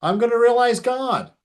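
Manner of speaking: put-on a boy who just heard the best news of his life, shouting it out; excited boy. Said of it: "the young boy is so excited".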